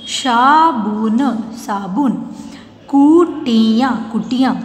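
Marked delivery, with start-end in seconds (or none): 0.1-1.2 साबुन very slow, each sound held; syllable by syllable. Shown as a demonstration. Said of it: "properly बोलना है". 2.9-3.9 कुटिया slow with each syllable stretched. Shown as a demonstration. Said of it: "properly बोलना है".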